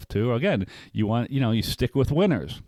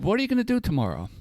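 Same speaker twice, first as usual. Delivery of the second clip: quick, higher voice for the calm friend; lightly voiced friend asking, brisk and high; Edson quoted; 10 semitones higher, much faster than usual. Friend Edson asking the venting man his plan, lightly voiced and quick. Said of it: "And he said".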